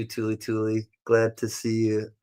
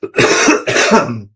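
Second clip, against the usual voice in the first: real cough; 7 dB louder than usual. Real, not acted. Choked on wine.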